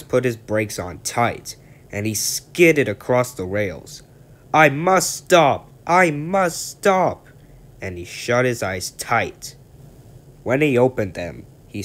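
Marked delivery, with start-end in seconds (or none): 4.5-7.3 high, loud, slow panicked cry; desperate cry. Acted. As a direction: strain to stop in time. Thomas skidding toward buffers.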